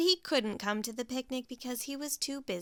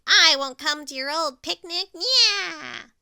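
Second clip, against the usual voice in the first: much louder, higher shriek; Nightingale shrieking; 5 semitones higher, 12 dB louder than usual. Acted. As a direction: shriek the refusal. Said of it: "Nightingale shrieked".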